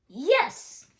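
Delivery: high, loud cheer; shouted. A narrator's reading. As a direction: shout yes together. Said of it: "shouted the pirates".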